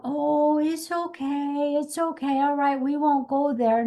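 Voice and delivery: coddle a scared horse a little too much; babying coo. Pretend. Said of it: "like Laura said".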